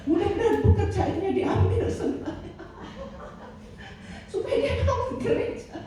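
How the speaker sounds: high mock curse of an angry pastor, laughing; angry pastor's curse. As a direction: ham up a pastor who wishes ruin on someone. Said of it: "pendeta kalau sudah marah".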